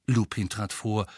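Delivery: ordinary delivery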